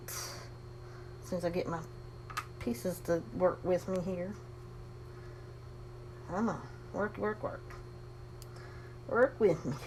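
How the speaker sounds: breathless asthmatic murmur; short of breath from asthma, quiet; breathing heavy. Real, not acted. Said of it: "I apologize if I'm breathing heavy".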